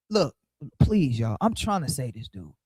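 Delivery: usual speaking voice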